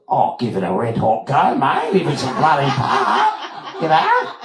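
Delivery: hammed-up Aussie enthusiasm, rising high; Margot, broad Australian accent. Actress agreeing to try for a part, broad Australian. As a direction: exaggerate the twang, end on a squeak. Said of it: "wrong accent".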